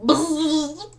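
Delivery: robot buzz. Pretend. Robot Clank makes a noise.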